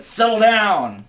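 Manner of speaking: stern slow parent command; firm dad voice. Retold or imitated. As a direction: shut it down like a dad. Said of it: "but I said".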